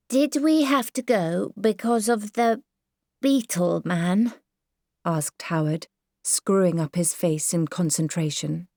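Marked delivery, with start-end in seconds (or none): 0.1-4.3 a lightly voiced weary small boy; tired little boy. Howard asks in concentration.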